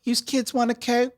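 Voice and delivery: high, exaggerated Philly accent; thick mock Delco accent; Philly family accent. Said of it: "whole family that sounds like that".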